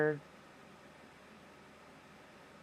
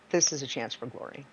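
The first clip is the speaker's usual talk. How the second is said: ordinary delivery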